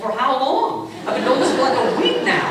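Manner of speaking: a laughing, high-pitched imitation of his daughter; indignant kid. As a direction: play the kid who can't believe it. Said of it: "She's like".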